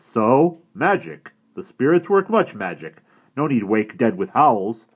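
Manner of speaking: put-on loud, stern brave scolding the boy; a proud warrior unimpressed by fuss; disapproving Indian brave. Indian character voice.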